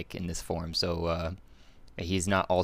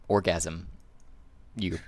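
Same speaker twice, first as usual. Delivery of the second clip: ordinary talk, nothing marked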